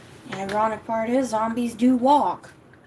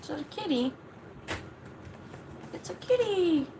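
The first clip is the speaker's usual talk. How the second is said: pet talk; 6 semitones higher, 7 dB quieter than usual. Real, not acted. Talking to her dog.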